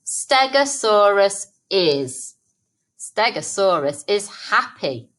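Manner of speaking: Stegosaurus is, drawn out slowly for writing; slow dictation. Shown as a demonstration. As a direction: stretch each word as if dictating. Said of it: "The first bit is written".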